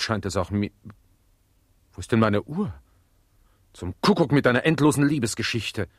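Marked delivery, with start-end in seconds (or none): 1.9-5.9 higher, louder, impatient outburst; irritated master. Acted. Master ordering Jakob to fetch his watch, louder and higher. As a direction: snap at the servant to hurry.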